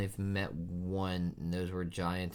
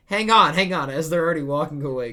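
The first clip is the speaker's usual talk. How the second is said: much louder, much higher, rushing; excited outburst; 9 semitones higher, 15 dB louder, faster than usual. Real, not acted. Viewer cutting in excitedly as the crew walks off. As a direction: burst in laughing over the scene. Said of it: "excited laughing outburst".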